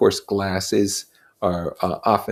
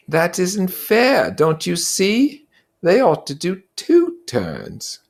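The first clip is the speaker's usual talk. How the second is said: high, earnest schoolboy voice, slowing to insist; a fussy boy pleading for fairness; Piggy's voice; 11 semitones higher, 4 dB louder than usual. Acted. Piggy voiced in read-aloud story.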